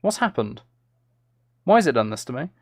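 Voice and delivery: usual speaking voice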